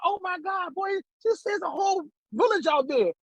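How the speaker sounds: high, exclaiming imitation of lady; comic high-pitched astonishment; retold amazement. Lady amazed by waiting crowd.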